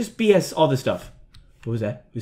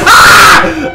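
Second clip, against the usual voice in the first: high, loud scream of fright; sudden yelp at a jump scare; startled scream; 11 semitones higher, 13 dB louder than usual. Real, not acted.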